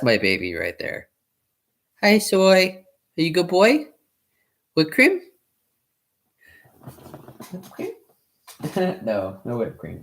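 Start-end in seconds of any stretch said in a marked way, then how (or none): 2.0-3.9 talking to a dog. Real, not acted. Baby talk to the dog. 4.8-5.2 talking to a dog. Real, not acted. Asking the dog twice. 7.5-7.9 talking to a dog. Real, not acted. Baby talk to the dog.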